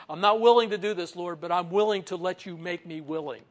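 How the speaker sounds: loud, earnest plea to the Lord; pleading prayer. Pretend. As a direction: cry out to God, reluctant but yielding. Preacher voices a reluctant prayer.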